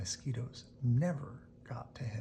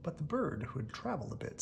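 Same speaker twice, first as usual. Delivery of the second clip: ordinary delivery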